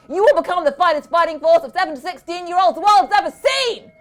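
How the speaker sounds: just as loudly. A narrator's reading. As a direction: rant at the children from his steed. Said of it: "he went on just as loudly".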